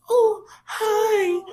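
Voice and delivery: very high, drawn-out coo; tiny-child falsetto; imitating toddler greeting. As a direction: squeal a sweet hello like a toddler.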